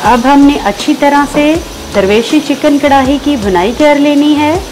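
ordinary talk, nothing marked

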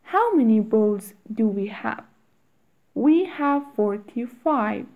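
quick, natural-speed question; natural fast speed. Shown as a demonstration. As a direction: ask it briskly at real speed. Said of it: "fast drill repeat".

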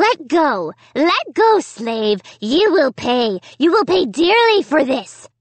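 high, loud screaming puppet voice; a furious little dummy shrieking to be let go. A narrator's reading. Slappy the dummy screams at Amy, furious and shrill. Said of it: "he screamed and protested".

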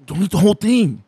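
high, loud imitated warning; urgent warning. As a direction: blurt out a friend's alarmed warning. Friend's urgent warning, acted in story.

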